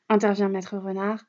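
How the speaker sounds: plain reading voice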